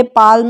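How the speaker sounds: पाल्म in one syllable, L sounded; wrong pronunciation. Shown as a demonstration. Teacher showing a mistake, पाल्म with audible L. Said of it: "नहीं पढ़ते".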